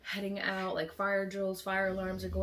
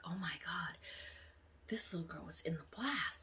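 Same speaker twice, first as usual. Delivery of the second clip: hushed gossip; 7 dB quieter than usual. Retold or imitated. Crowd realizing in hushed tones.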